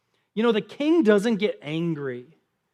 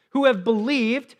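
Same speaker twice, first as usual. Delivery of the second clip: ordinary talk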